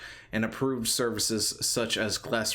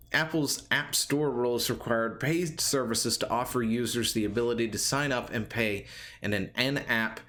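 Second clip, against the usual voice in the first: usual speaking voice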